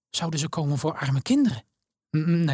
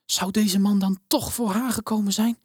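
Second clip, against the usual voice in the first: ordinary delivery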